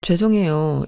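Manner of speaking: ordinary delivery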